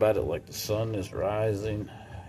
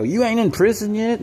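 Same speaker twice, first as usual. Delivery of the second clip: mock jab; 11 semitones higher, 5 dB louder than usual. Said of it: "I gotta go".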